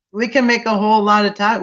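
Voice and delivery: normal talking voice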